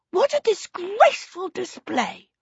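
high, disapproving little female kobold voice; scandalised lady. A narrator's reading. Lady kobold disapproves of Rollo's screaming, high scandalised voice. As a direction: pitch it high and tut with disgust.

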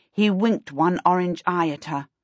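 plain reading voice

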